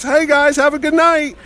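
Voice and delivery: high, cheery called-out farewell; Adam West. Retold or imitated. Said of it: "he's waving to his guys".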